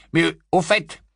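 plain reading voice

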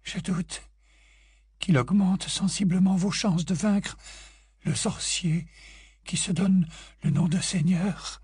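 high, slow, controlled ghostly tone; steady your voice with effort; composing herself. A narrator's reading. Grey Lady regaining control before Harry, slow and measured.

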